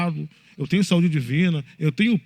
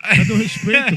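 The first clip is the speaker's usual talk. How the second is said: louder, hammed-up outrage; mock indignation; 5 semitones higher, 7 dB louder than usual. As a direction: play the offended pastor big. (pastor fingindo indignação, mais alto e exagerado)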